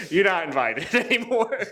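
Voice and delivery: high, laughing through the words; laughing mock rejection. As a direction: turn them away while cracking up. Mock rejection, laughing while retelling.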